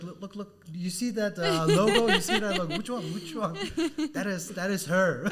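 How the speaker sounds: high, laughing through the quote; laughing retold quote. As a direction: retell his words while cracking up. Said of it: "he told me, like".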